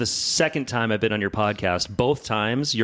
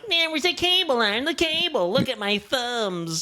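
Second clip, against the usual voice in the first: high whining imitation; whiny mock voice; 14 semitones higher than usual. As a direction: whine like a complaining coworker. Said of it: "She probably went more like".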